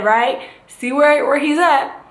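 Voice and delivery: ordinary talk, nothing marked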